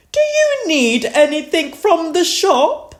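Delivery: high, loud granny pig voice. A narrator's reading. Granny Pig offers shopping, in a high, loud voice. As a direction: ask with a high rising lilt. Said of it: "Granny Pig asked".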